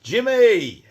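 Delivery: loud, high, stretched name greeting; hearty call-out. Real, not acted. Streamer greeting a viewer by name, loud hearty call. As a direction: holler the name to hail a mate. Hailing a viewer who joins.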